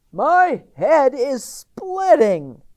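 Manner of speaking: loud, high, slow complaint; a woken animal nursing a headache; groaning farm animal. A narrator's reading. Animal complains after the crow.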